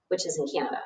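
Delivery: low, softly dropped aside; quiet aside. Acted. Arthur explaining where Balzac is, quiet low aside.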